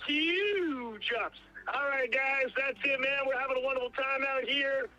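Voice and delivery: Jeff Lepard. Acted. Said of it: "can you do your Jeff impression".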